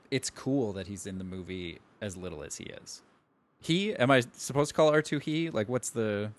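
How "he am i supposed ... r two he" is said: puzzled question. Real, not acted. Questioning the pronoun for a droid.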